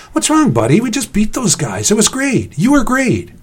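high-pitched, pumped-up cheering; excited encouragement. A narrator's reading. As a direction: buck up a friend after a win. Cheering on upset friend.

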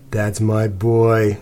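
very slow, drawn-out pet praise; praising. A narrator's reading. Cece to the circling dog, praising. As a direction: stretch each word, cooing to the dog.

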